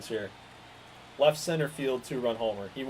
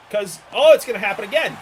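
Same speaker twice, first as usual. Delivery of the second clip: much louder, higher panicked cry; alarmed outburst; 7 semitones higher, 11 dB louder, faster than usual. Real, not acted. Fan bracing for the same disaster, loud and higher. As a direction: burst out in dread. Dreading another home run.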